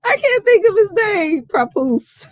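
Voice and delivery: high voice with laughter running through; can't stop laughing; still giggling. Real, not acted. Tipsy host still hunting for the name, giggling.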